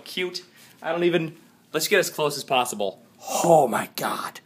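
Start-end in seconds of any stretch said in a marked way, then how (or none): 3.4-4.4 breathy whispered gasp of awe; awed whisper. Real, not acted. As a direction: whisper it like you can't believe it.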